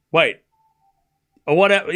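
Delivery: normal talking voice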